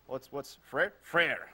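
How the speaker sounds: a playful fake French accent. Host taunting viewers in hammy French accent. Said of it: "my terrible French accent".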